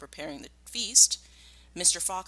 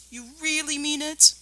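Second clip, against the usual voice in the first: hopeful cry; 11 semitones higher, 6 dB louder than usual. Acted. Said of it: "cried Badger".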